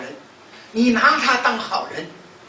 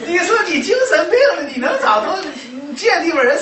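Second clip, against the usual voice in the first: laughing; 8 semitones higher, 7 dB louder than usual. Real, not acted. Laughing at the patient's plan.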